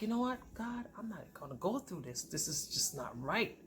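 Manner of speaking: quietly resolved. Retold or imitated. Woman telling God she's done, quietly resolved. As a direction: say it under your breath, retelling it.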